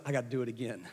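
low, soft quoted aside; retold quiet resolve. Pastor after watching the kids, low quiet resolve. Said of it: "He goes".

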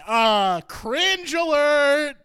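loud, high, drawn-out siren-like call; mock alarm announcement. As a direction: blare it like a hammed-up warning. Mock alarm announcement over the film.

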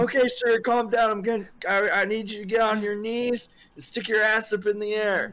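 excited high-pitched instructions, retold; urgent rescuer, acted. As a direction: take charge in hammed-up alarm. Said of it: "I'm like".

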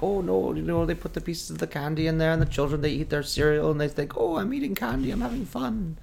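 put-on a comic German accent that gets squeakier as it goes; mock German accent. Retelling the Austin Powers Lucky Charms bit to the cohost in a mock German accent. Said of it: "Is that your Irish accent?".

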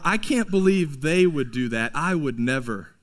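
mock self-righteous scoff, sinking low. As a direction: look down your nose at them. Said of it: "we pridefully turn up our noses".

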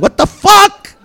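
very high, loud disbelieving yelp; high, loud squeal of disgust; shocked outburst. Real, not acted. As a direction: blurt it out in sheer shock. Seeing the goat shitting.

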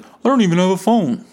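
high, loud, defensive denial; mock defensive driver. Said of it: "He's like".

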